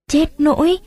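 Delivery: high, rising cry on nỗi; Chết nỗi with nỗi lifted high in alarm; dismayed gasp. A narrator's reading. Tuất reacts to the news of the arrest, high and dismayed.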